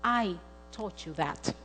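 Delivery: slow, soft, low admission; sober confession. Retold or imitated. Mother admits blame to her daughter, sober confession. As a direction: own up slowly and gently.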